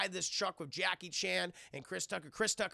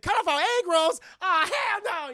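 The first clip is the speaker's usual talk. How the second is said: much higher, louder mock freak-out; panicked shout; 12 semitones higher, 12 dB louder than usual. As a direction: yell it like he's losing it. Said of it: "He's like".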